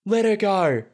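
high-pitched retold plea; imitated desperate cry. Retelling Matt pleading with the boar, mock-desperate. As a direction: act out calling off an attacker, pitch way up.

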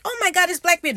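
high, loud frightened cry; mock panic. Danes spotting Blackbeard and running away, mock panic.

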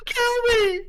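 very high mock-terrified squeal; imitated kid screaming. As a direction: squeal like a kid fleeing in play terror. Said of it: "they just start screaming, running away".

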